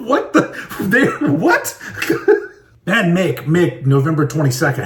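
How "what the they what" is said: higher, laughing disbelief; amused disbelief at matching birthdays; laughing surprise. Real, not acted. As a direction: react in amused shock.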